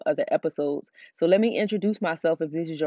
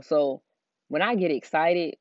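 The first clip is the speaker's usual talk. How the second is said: normal talking voice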